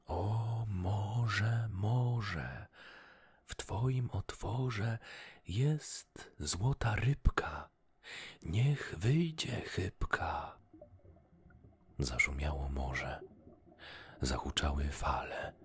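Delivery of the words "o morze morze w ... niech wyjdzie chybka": slow, higher-pitched, drawn-out incantation; calling out. A narrator's reading. Fisherman calling the golden fish out of the sea, chanted call. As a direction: summon with long stretched vowels. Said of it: "i zawołał".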